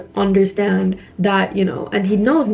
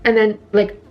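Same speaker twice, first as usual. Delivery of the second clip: usual speaking voice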